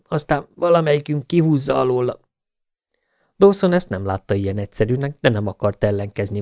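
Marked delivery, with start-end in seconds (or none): 0.1-2.2 higher, breathy panting voice. A narrator's reading. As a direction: gasp it out from the effort. (lihegve, magasabb hangon)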